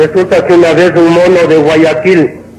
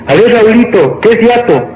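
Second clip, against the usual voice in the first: higher, quizzing teacher voice; acted schoolteacher; 6 semitones higher than usual. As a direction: call on a pupil like a teacher. Teacher questioning pupil in joke. (maestra preguntando, tono agudo)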